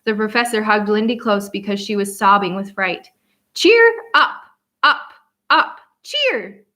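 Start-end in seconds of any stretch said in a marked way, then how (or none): 3.5-6.6 high, loud squawking chant; bird squawk. A narrator's reading. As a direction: squawk it like a parrot.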